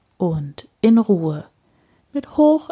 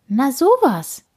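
surprised thought; 7 semitones higher than usual. A narrator's reading. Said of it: "denkt Frau Usebuse".

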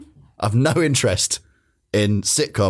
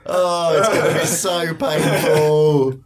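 high, drawn-out anguished moan; mock agony; 5 semitones higher, much slower than usual. England fan dreading the World Cup, mock agonised. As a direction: wail it slowly, dreading the pain.

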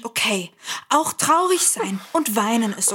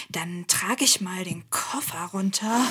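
whisper; 5 semitones lower, 5 dB quieter than usual. Acted. Sneaking off to carry suitcase.

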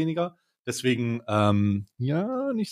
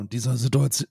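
normal talking voice

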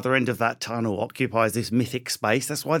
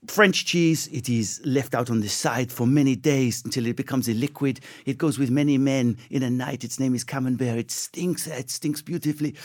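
put-on French accent. Comedian playing French attitude to cheese, French accent. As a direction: describe it as a comic Frenchman. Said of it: "compared to the French attitude".

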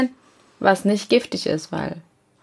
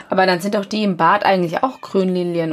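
normal talking voice

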